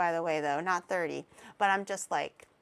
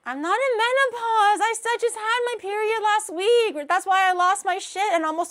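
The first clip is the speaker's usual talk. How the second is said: much higher, louder indignant denial; indignant protest; 8 semitones higher, 5 dB louder than usual. Real, not acted. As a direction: protest loudly to chat, half-joking. Defending herself to chat.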